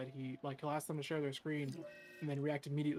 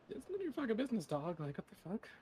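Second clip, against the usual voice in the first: retold annoyance; 7 semitones higher than usual. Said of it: "I was like".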